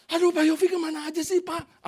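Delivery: high-pitched mock complaint; imitated fussing woman. Woman chides the pastor she finally found, high fussing. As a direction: play her exclaiming, voice pitched well up.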